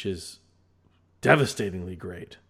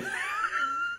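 squealing burst of laughter; high shrieking laugh; 4 dB quieter than usual. Real, not acted. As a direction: let out a shrill squeal of a laugh. Laughing at a joke.

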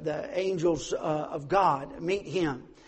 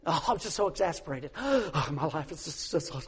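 a hammed-up sigh of self-pity, softer; mock self-pity; 3 semitones lower, 5 dB quieter than usual. Preacher mocking people who seek sympathy, hammed-up self-pity. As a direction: fake a weary plea for sympathy. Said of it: "trying to get sympathy".

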